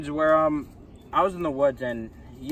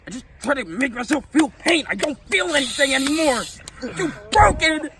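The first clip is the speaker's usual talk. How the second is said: high, loud, wailing; high hammed-up sob of despair; mock anguish; 9 semitones higher, 7 dB louder than usual. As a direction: ham up a broken cry. Character laments feeling nothing.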